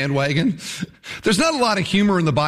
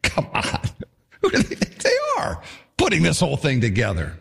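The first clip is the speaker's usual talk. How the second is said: scoffing laugh; 2 semitones lower than usual. Acted. Mocking the builders.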